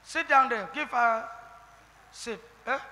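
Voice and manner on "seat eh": usual speaking voice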